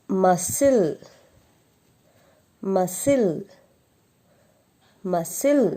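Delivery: muscle said mus-sl, the c silent; model pronunciation. Shown as a demonstration. Teacher drilling the word for pupils, clearly. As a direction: say muscle with no k sound. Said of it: "drilled word".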